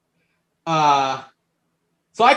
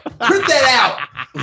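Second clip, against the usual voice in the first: louder and higher; excited demand; 6 semitones higher, 4 dB louder than usual. Retold or imitated. To his cousin with the test results, an excited loud demand. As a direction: bark the order gleefully.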